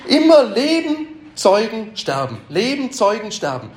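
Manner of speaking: lightly voiced dismay, rising high on Leben and falling on Sterben; a touch of a shaken convert's dismay; shaken lament. Preacher retelling a convert's shock, with a hint of dismay. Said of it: "Das hat sie so erschüttert".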